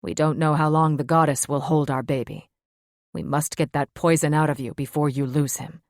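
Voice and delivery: urgent male mate. Acted. Luke urging, lower voice.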